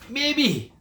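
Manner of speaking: high, loud sneering echo of maybe; mocking echo. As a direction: throw his word back with a sneer. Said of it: "the other farmers are thinking".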